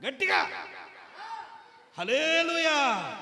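high, loud, slow drawn-out hallelujah. Shown as a demonstration. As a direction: lead them in a big hallelujah. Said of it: "గట్టిగా చెప్పు".

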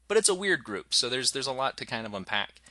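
ordinary talk

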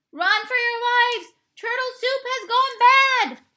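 frantic cook yelling from the kitchen; panicked shout. Acted. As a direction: scream a warning to everyone. Kitchen commotion, alarm.

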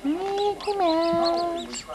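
high, slow drawn-out coo; sweet high cooing at the infant. Real, not acted. Coaxing baby.

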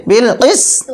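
slow বিল কিস্ত, long s, detached final release; slowly drilled বিল কিস্ত; pausal consonant cluster. Shown as a demonstration. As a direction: stretch the s in কিস্ত, then bounce the ending. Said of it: "সিন আর ত একসাথে".

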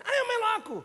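high, loud mocking challenge from a brawler; taunting thug. Kung fu boss taunts his followers, a high loud jeer. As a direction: goad his followers to rush forward.

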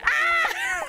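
high, short scream of shock; mock-startled "ah"; reenacted yelp. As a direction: mimic your own startled yelp. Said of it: "and I'm like".

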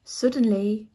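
usual speaking voice

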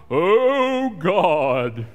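mocking a showy, self-praising prayer; pompous mock prayer. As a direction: ham up a boastful prayer to God. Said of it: "they'll talk to you like this".

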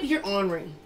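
ordinary talk

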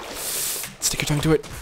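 slow breathy whisper. Acted. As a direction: hush it like a secret. Repeats phrase whispered.

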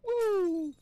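high, loud whoop; long high woo; gleeful whoop. Pretend. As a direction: whoop with playful glee. I'm just having fun.

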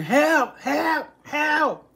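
mock cries of help, rising high; imitating a child yelling help; child screaming for help. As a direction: call out help like a scared kid.

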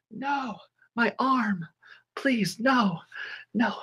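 gasp, then high, soft, slow pleading; hammed-up victim fading away; mock agony. Teacher pretending pupils eat her arm, mock dying pleas.

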